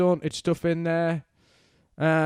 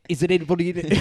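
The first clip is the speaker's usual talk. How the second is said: put-on, muddled Scouse medium voice; botched Liverpool accent; 3 semitones higher, much faster than usual. Podcaster mimicking a TV medium's spirit voice, botched Scouse accent. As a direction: have a bad go at a Scouse accent. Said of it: "I don't know what accent that was".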